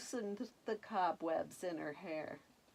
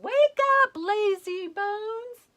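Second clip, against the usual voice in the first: big high shout to wake her; hollered; 13 semitones higher, 12 dB louder than usual. A narrator's reading. A fairy hollering at sleeping Beauty, high and loud. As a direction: bellow it to rouse a sleeper.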